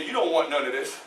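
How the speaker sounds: very low, soft warning; quiet, private challenge never meant to be heard; inner-thought aside. Acted. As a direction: say it under your breath. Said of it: "In my mind, I'll say".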